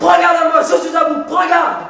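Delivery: furious lover's threat; threatening shout. Acted. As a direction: warn as one at the end of patience. Jealous man threatens her.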